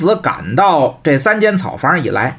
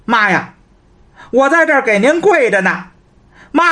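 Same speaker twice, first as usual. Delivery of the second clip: whining son; 7 semitones higher than usual. Acted. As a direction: call out to his mother from outside, pitifully. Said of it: "只好叫娘". (在门外可怜巴巴地喊娘)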